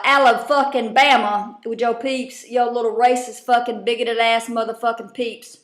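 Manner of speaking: ordinary delivery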